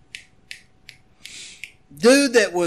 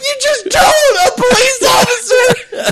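laughing disbelief; 13 semitones higher than usual. Retold or imitated. Exasperated laughter.

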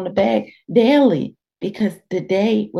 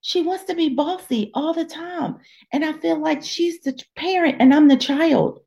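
much higher, breathless shocked realization; 11 semitones higher than usual. Retold or imitated. Reader realizing her friend is controlling, gasping and much higher. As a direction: gasp and discover the truth about her friend. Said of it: "you're reading this and you're like".

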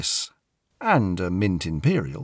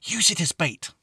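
put-on little girl instructing; 7 semitones higher than usual. A narrator's reading. Lucy instructs the boy.

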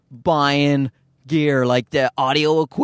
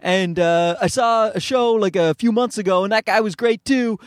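higher-pitched excited fan voice; gushing audience member; 7 semitones higher than usual. Acted. Audience member praising comic after show, gushing. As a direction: gush in a raised, put-on voice. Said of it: "an audience member will come up afterwards".

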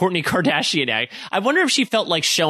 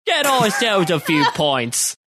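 mock British accent; 2 semitones higher, slower than usual. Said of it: "British impersonating".

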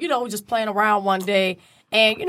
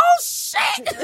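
high laughing oh shit; high, laughing shock. Retold or imitated. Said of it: "I'm like".

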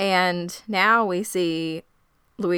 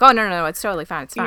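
rapid-fire retold reassurance, louder; hurried brush-off; 5 dB louder, much faster than usual. Rejected suitor telling Mildred it's fine, hurried brush-off. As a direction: rush the words out to wave it away. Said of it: "he immediately knew it was coming".

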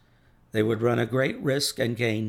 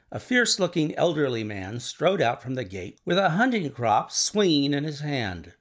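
usual speaking voice

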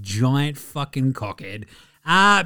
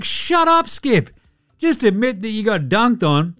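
exasperated yell; 5 semitones higher than usual. Real, not acted. Ranting at Skip Bayless, exasperated yell. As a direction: snap at him in disbelief. Yelling at pundit.

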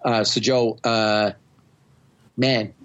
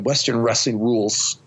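usual speaking voice

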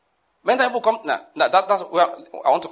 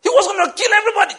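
high, loud mock sentimental gush; 8 semitones higher, 5 dB louder than usual. Preacher mocking a sentimental reading, high hammed-up gush. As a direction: ham up a sappy believer. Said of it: "It's not".

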